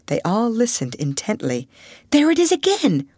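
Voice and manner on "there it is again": ordinary delivery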